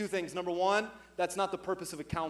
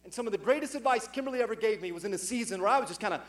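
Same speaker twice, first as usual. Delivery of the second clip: normal talking voice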